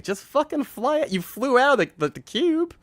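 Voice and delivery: high-pitched incredulous complaint; exasperated protest. Retold or imitated. Film fan arguing with Superman on screen, high exasperated protest. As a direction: plead with the screen in mock exasperation.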